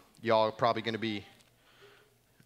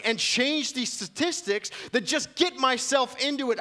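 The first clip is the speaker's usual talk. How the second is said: much higher, loud impassioned preaching; preaching at full force; 12 semitones higher, 7 dB louder than usual. Acted. Pastor to congregation preaching about going far, impassioned. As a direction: lift them with a passionate cry for legacy.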